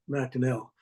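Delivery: MacDonell ending in a clear -ell; Winnipeg pronunciation. Shown as a demonstration. Author explaining local Winnipeg usage, MacDonell ending in -ell. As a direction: say MacDonell with "-nell" at the end. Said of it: "it is universally pronounced".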